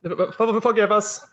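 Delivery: embarrassed, caught off guard; flustered high stammer. Acted. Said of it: "Their faces flush".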